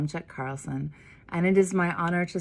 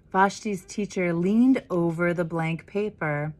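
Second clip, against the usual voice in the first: put-on lively read-aloud for children; story narration; 3 dB louder than usual. A narrator's reading. Minister reading The Dot to the congregation, lively storytelling. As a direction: read it like a picture book to kids. Reads picture book to children.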